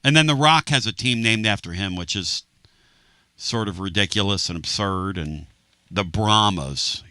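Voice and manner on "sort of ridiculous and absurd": ordinary talk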